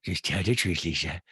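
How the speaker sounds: low put-on self pep talk; mock-earnest, dropped pitch; Rinna. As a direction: mutter it low as self-coaching. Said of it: "but you're like".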